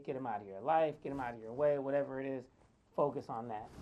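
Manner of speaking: ordinary talk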